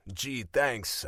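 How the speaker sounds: plain reading voice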